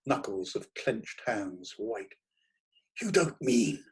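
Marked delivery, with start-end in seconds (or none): 3.0-3.8 intense. A narrator's reading. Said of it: "said I with intensity".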